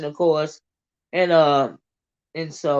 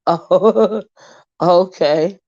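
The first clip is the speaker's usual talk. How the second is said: louder, laughing mimicry of a reaction; laughing imitation; 5 dB louder, much slower than usual. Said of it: "I was laughing at how".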